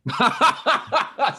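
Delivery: loud high laugh; high laughing burst. Real, not acted.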